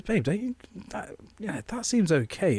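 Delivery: high, soft, hesitant wife voice; imitating a tentatively persuaded wife; tempted wife. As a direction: float it gently, coaxing a partner. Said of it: "my missus was a bit like".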